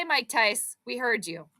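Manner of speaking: usual speaking voice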